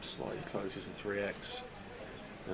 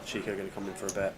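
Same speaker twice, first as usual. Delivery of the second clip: hushed poker commentary; 5 dB quieter than usual. Acted. Quiet play-by-play commentary.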